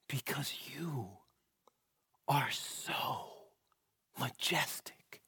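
hushed whisper, slow on majestic; awed whisper. Acted. Preacher marvels at Jesus's majesty, whispered in awe. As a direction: breathe it in awe.

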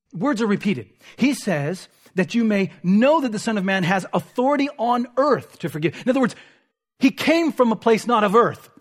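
ordinary delivery

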